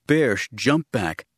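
plain reading voice

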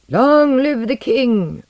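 high, loud, slow announcement; ringing proclamation. A narrator's reading. Ambassador answering the protesting king, proclaiming. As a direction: proclaim it like a herald.

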